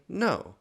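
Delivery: NO jumped way up high; emphatic no. Shown as a demonstration. Pastor answering God's rhetorical question, emphatic. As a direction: land No as the obvious answer. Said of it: "an emphatic no".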